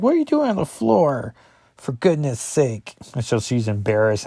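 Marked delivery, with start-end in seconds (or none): 0.0-3.0 high, exasperated parent voice; hammed-up parent scolding; mock scolding parent. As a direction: play the fussing parent catching her on the floor. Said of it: "they're like".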